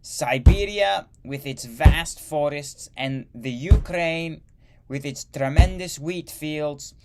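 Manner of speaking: loud, high-pitched ranting in a hammed-up German accent; bad German accent. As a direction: proclaim the conquest like a mock Hitler in a German accent. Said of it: "That was a really bad accent".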